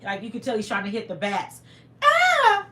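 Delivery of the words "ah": loud mock falsetto shriek; imitated high-pitched scream. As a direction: scream high while swinging at bats. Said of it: "His voice went up five".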